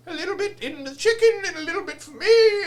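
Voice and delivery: tipsy TV cook in high falsetto; sing-song it very high and loud; hammed-up boozy cooking-show lady impression; Julia Child. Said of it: "And she used to always drink".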